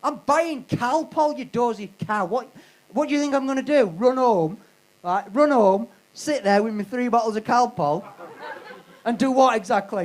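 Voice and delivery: mock furious rant at the shop cashier; exasperated rant. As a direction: scold the dozy cashier with growing outrage. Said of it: "And then I went".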